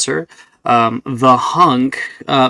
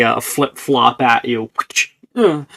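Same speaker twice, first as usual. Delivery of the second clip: ordinary talk, nothing marked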